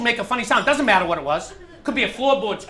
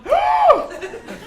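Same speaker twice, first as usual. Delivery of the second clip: startled gasp. Acted. Startled by house noise.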